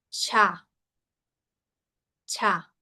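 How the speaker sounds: "Cha" with an aspirated ch; Mandarin ch sound. Shown as a demonstration. Teacher showing students an aspirated Chinese sound. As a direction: breathe out hard on the ch of "Cha". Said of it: "la diferencia con estos sonidos asiáticos".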